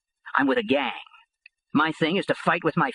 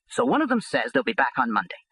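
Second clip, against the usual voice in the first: ordinary delivery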